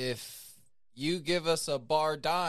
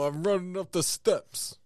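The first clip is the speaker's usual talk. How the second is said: Rocky impression; 4 semitones higher, 4 dB louder than usual. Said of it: "It's Sylvester Stallone".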